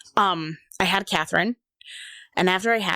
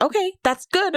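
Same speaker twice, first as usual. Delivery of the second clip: bright doctor voice; 12 semitones higher than usual. Retold or imitated. Voicing the doctor on the phone.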